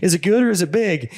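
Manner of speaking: high, eager retold question; excited questioning. Hunter asking guide about her bear, excited. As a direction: imitate an excited hunter pressing for an answer.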